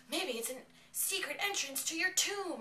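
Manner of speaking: high, loud put-on voice; mock prompting. Retold prompt relayed by Clara.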